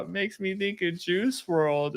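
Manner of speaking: lightly voiced mournful kid, high, soft and slow; grieving young son. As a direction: imitate a boy mourning his idol. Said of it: "even a six-year-old".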